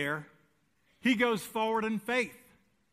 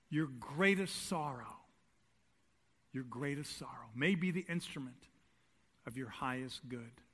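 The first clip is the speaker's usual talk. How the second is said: tender; 6 semitones lower, 7 dB quieter than usual. Acted. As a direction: ease down and comfort them. Tender preaching point.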